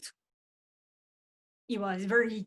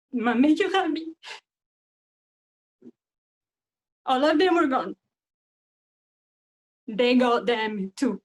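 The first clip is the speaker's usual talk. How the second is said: put-on raised, anguished French-accented outcry; anguished grief; 5 dB louder, slower than usual. Character recounts losing whole family.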